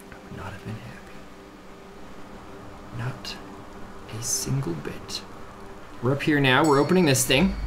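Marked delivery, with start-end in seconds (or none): 0.1-5.2 whispering. Real, not acted. Relief aside.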